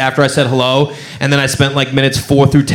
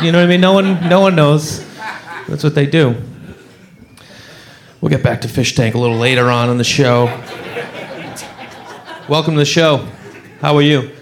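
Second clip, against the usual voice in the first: ordinary talk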